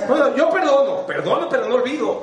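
a hint of a smug "I forgive but don't forget" voice, pitched high; mocking people who forgive but never forget; mock grudging forgiver. Speaker mocks grudging forgivers in a high voice. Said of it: "Como los que dicen".

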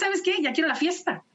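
high-pitched mock child's eagerness; imitated eager girl. Daughter telling mom she wants her party, imitated eagerly. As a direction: play a kid claiming a promised treat.

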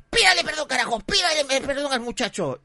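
high, loud screamed order; out-of-control grandmother. Retold or imitated. Grandmother ordering son to apologise, high screaming voice. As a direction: shriek the demand like an enraged granny. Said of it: "la vieja se descontrola".

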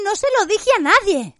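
distraught shout. A narrator's reading. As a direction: cry it out betrayed. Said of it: "gritó Hermione".

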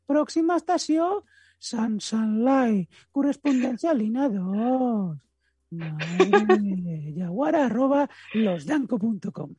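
high, slow station announcer voice; Barcelona metro voice. Retold or imitated. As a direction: announce the next stop like the metro. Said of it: "que va a ser la voz del metro de Barcelona". (voz de megafonía del metro de Barcelona)

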